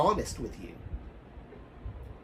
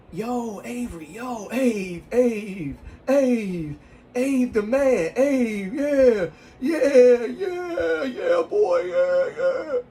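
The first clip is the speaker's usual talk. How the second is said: high, loud imitation of cheering guys; mock hollering, pitched way up; hyped-up schoolmates; 9 semitones higher, 6 dB louder, much slower than usual. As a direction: draw out the yeahs like a rowdy hype crew. Guys hyping him up at school.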